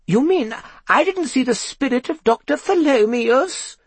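very high, forlorn character voice. A narrator's reading. Gloria learns she saw no spirit, forlorn. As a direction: plead in a high, let-down voice. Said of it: "asked Gloria forlornly".

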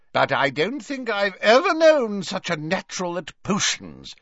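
put-on high-pitched, effusive old professor; tipsy Slughorn, fond. Merry professor praises his favourite pupil at a party, tipsily effusive. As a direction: gush fondly in a high tipsy voice.

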